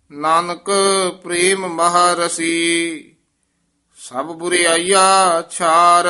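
measured chant, held line endings; scripture recitation. Acted. Teacher leads students through Gurbani, devotional recitation. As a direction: recite the verse slowly on a near-level note.

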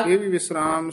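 usual speaking voice

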